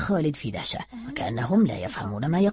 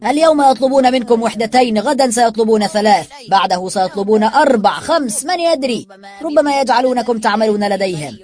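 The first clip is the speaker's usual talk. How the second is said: higher and louder rallying speech; rising, louder oration; raised voice; 7 semitones higher, 9 dB louder than usual. A narrator's reading. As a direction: rouse the crowd again. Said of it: "ثم علا صوته مجددا".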